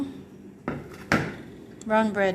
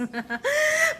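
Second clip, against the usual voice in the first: high laughing squeal. Real, not acted. Laughing about the pencils.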